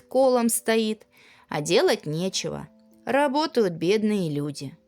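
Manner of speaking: ordinary delivery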